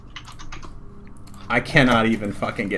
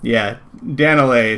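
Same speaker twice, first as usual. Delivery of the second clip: ordinary delivery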